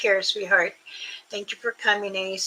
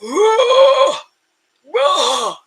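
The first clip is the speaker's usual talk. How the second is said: high, loud shouted whoa; imitated creature call; 11 semitones higher, 10 dB louder than usual. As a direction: call out like something in the forest. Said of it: "You will hear".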